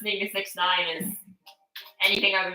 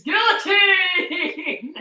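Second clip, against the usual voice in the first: mock scream, high and drawn out very slow; hammed-up higher drawn-out yell; imitating a scream; 10 semitones higher, 3 dB louder, much slower than usual. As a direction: stretch the word way out like a rap scream. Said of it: "He's the guy who screams".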